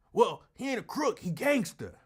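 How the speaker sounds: high, animated supporter impression; imitated indignant comeback, pitched high; mock defensive supporter. Mimics supporter defending his candidate.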